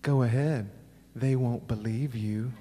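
soft, slow imitated comeback; hushed, measured dare from a kid; little brother's retort. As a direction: quietly call the bluff, slowing down. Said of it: "And he went".

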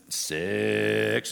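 stretched referee's number; slow-motion count. Retold or imitated. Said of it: "slows down to slow motion".

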